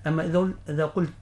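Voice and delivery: ordinary talk, nothing marked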